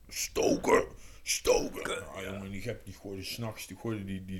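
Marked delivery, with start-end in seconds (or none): 0.1-2.1 forceful hoarse, whispered shout; loud voiceless bark, mimicking; imitated stern order. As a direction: rasp out the command with no real voice. Imitating Luc's order to stoke.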